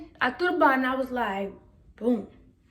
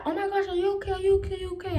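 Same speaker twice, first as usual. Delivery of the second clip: mock worried girls; 7 semitones higher, much faster than usual. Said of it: "they was like".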